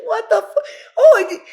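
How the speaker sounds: high, laughing through it; cracking up at the memory. Real, not acted. Teller laughing while recalling Joey grabbing his cheeks.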